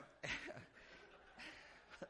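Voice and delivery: airy rising laugh; breathy laugh. Real, not acted. Pastor still laughing at his joke, breathy rising laugh. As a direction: let the laugh escape up high.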